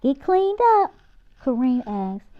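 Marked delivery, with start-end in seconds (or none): 0.0-0.9 calling out. Acted. Mom calling kids from kitchen.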